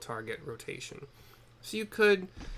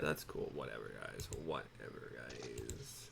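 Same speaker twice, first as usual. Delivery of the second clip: a much lower, softer, deep sulking mumble; mock sulky grumble; 12 semitones lower, 11 dB quieter, slower than usual. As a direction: pretend to sulk at the group. Sulking about being left behind.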